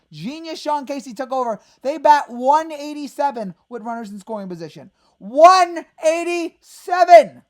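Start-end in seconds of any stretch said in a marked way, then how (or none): 5.2-7.4 drawn-out, disbelieving yell, much louder; slow outraged shout of the stat; exasperated shout. Real, not acted. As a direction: bellow the number slowly in disgust. Fan ranting over batting stats.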